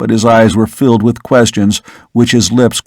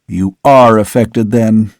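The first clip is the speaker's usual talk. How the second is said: plain reading voice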